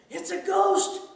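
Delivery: high, loud frightened cry; dramatized scream of alarm. A narrator's reading. Disciples see a figure on the waves, screaming. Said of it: "they screamed".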